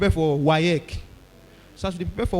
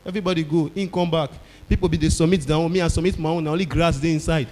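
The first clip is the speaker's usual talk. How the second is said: ordinary delivery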